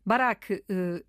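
normal talking voice

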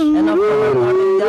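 loud, high mock proclamation; mock dramatic. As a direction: declare it like a conspiracy. Mock dramatic repeat of headline.

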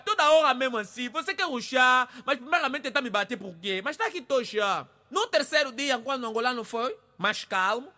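normal talking voice

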